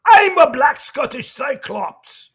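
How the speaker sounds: slow, loud Scottish bellow; Demo Man voice. Pretend. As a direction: proclaim it like a drunk Scotsman. Proudly declaring in character.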